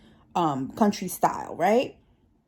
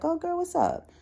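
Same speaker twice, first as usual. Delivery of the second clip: put-on casual girl greeting; 11 semitones higher than usual. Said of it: "And like".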